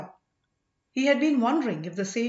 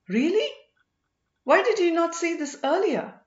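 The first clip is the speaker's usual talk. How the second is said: higher and louder, eager disbelief; surprised delight; 8 semitones higher, 5 dB louder than usual. A narrator's reading. Vikraman learning the princess will leave with him, surprised. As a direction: lift it up, eagerly astonished.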